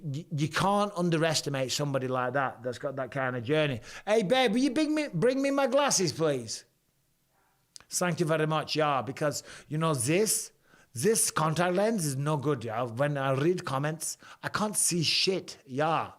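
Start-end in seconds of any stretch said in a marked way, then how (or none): 4.1-6.6 high-pitched call across to partner. Real, not acted. Streamer asking his partner to bring glasses, calling out. 7.9-16.1 put-on comic German accent. Streamer joking about his bad contact lens, German accent. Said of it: "that is my German accent for you".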